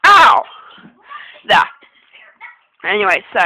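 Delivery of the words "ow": sudden loud yelp of pain; sharp loud ow. Real, not acted. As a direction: cry out high and loud. Hair rubbed in her face.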